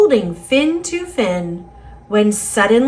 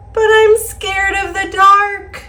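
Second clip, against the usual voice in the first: higher and louder, a frightened cry; scared; 9 semitones higher, 4 dB louder than usual. Acted. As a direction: let the fear burst out on the last line. Fish admits fear, refrain.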